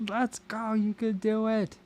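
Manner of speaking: high, drawn-out cheer without real volume; a held-back pretend scream of encouragement; mock scream. Pretend pacer to runners who are really close, mock screamed cheer. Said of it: "you could just scream at 'em".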